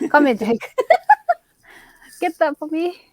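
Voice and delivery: usual speaking voice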